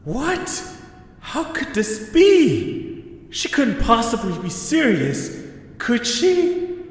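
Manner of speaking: high, bewildered questions; stunned disbelief. Acted. Eche stunned after Taisene confesses, high disbelief. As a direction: reel from the shock, voice up high.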